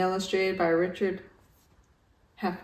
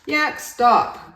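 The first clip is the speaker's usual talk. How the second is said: groaning; 5 semitones higher, 7 dB louder than usual. Acted. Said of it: "groaned Walrus".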